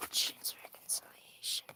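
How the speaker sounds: very soft whisper; whispering to self. Real, not acted. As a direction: whisper it under your breath while reading. Whispers question to self over cards.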